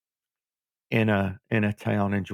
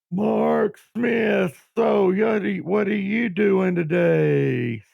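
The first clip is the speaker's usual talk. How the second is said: put-on high, slow drawling old wrestling manager; imitating the old manager's slow drawl; 9 semitones higher, 3 dB louder, much slower than usual. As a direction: lay on a slow mobster-style drawl. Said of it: "had this long drawl".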